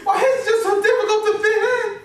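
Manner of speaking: high-pitched breakdown with sobbing; crying. Acted. College student despairing alone over rejection, sobbing. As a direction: wail it out, overwhelmed and sobbing. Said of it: "Quit crying, little baby".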